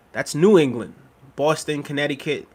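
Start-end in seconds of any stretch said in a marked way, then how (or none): none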